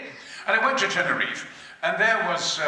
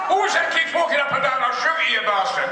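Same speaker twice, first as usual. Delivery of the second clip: mock yell; 6 semitones higher than usual. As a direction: roar at someone pacing about. Mock threat at someone pacing.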